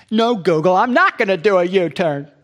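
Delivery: playfully exasperated driver shouting at the sat-nav; mock outrage. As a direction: argue back loudly, hammed-up. Acted argument with the GPS.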